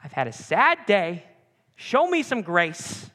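hammed-up defensive complaint; self-justifying. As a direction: plead your own excuse, drawn out. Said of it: "we justify and say".

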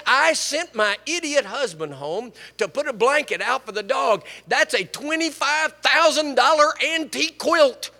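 loud, high-pitched indignant retelling; retold exasperation. Woman fuming to the preacher about her idiot husband, exasperated. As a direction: play up the outraged wife, loud and high.

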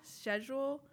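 "Schedule" with an opening sh sound; British pronunciation. Shown as a demonstration. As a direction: say "Schedule" as shed-jool, British-style. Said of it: "how you say it with the British accent".

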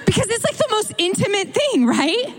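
high, giddy mock gushing. Preacher on whispering's intimacy, high playful gushing.

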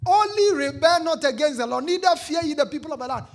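bold speaking. Acted. Caleb urging the people, loud bold exhortation. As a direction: urge them on fearlessly. Preaching at full force.

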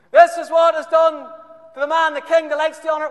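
very loud, high herald shout; shouted proclamation. Acted. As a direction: bellow it down the street.

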